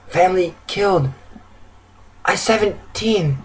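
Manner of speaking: put-on weak traumatized girl; raspy, terrified. A narrator's reading. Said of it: "broken speech and raspy, terrified voice".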